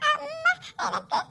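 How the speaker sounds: high, very slow, soft plea; pleading child. Acted. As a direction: draw out a hungry plea. Child starts to beg. (மெதுவாக கெஞ்சும் குழந்தை)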